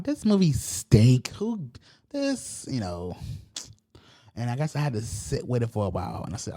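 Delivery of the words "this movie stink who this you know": louder, slow, high-pitched complaint at the movie; hammed-up disgust, dragging it out; mock outrage. Viewer ranting about the movie right after watching it, hammed-up outrage. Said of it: "I'm like".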